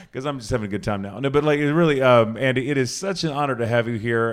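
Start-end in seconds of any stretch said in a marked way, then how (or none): none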